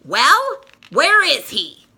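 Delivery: put-on furious girl confronting Linus; fuming. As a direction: demand an answer, outraged. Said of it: "Sally fumes".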